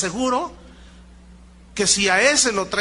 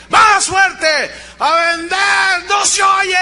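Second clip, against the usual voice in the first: very high, loud demanding yell; mock coach yell; 11 semitones higher, 9 dB louder, much slower than usual. Motivator demanding louder answers, yelling. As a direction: scream for more. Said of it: "Dígalo más fuerte".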